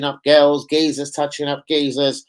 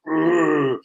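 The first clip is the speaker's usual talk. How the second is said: flexing grunt. Retold or imitated. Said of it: "I was like".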